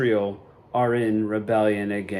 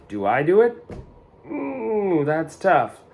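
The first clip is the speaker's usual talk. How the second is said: usual speaking voice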